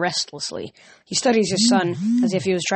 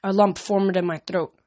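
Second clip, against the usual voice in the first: ordinary delivery, nothing marked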